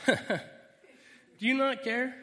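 a disciple yelling at Jesus, indignant; exasperated outburst. Acted. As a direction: burst out at the sleeping teacher.

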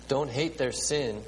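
normal talking voice

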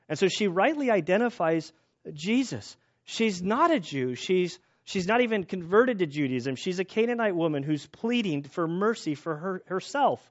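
ordinary talk

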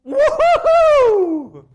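very high, loud whoop of joy; joyful woohoo. Retold or imitated. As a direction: whoop like the thrilled women. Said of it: "these women are going".

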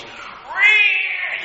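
sudden high squeak of an angry cub; high piercing cub squeal; bear cub squeal. Retold or imitated. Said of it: "whatever his little squeal is".

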